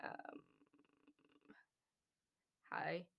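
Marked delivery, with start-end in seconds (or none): 2.7-3.1 very low deep hi. Shown as a demonstration. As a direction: drop the voice as low as it goes. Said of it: "hot guy voice?".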